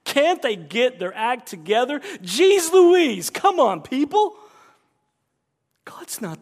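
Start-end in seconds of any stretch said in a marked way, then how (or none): 0.0-4.4 higher and much louder mock exasperation; exasperated rant. Preacher mocking an exasperated God figure. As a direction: throw up your hands in mock disbelief. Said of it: "God doesn't throw his hands up ... and say".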